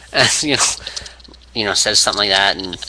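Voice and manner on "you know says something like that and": usual speaking voice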